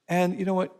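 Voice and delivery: ordinary talk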